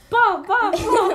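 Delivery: loud, high mock smacking sounds; imitated hitting noises. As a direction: punch out each bah, loud and high. Mimics being hit by friend.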